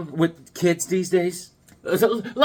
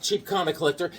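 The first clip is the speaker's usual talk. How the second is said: normal talking voice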